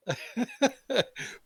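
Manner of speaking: squealing high laughter. Real, not acted. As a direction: let a high laugh burst out. Laughing at the joke.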